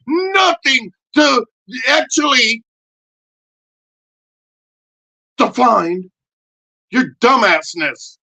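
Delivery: high, loud puppet shouting a defiant rant. Acted. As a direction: rant as the cocky pep puppet.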